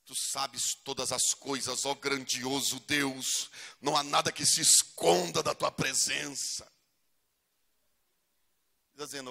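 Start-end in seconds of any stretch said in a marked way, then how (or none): 0.0-6.6 slow, solemn, pompous prayer voice; hammed-up holy prayer; mock spiritual prayer. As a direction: put on a grand churchy tone. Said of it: "dá uma de espiritual".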